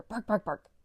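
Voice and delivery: hammed-up dog barks; barking. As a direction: bark like the dogs. Dogs bark together loudly.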